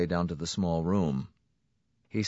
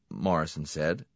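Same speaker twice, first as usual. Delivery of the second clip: plain reading voice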